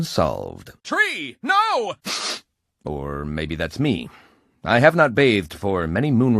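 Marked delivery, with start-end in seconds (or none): 0.8-1.9 sudden high cry of alarm. Acted. Hero cries out as the tree falls, high alarmed cry. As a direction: cry out in dismay as the tree goes.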